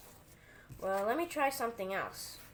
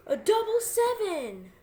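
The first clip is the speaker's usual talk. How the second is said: high, slow, amazed exclamation; mock amazement; 12 semitones higher, 9 dB louder, slower than usual. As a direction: act stunned by the reveal. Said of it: "people will be like".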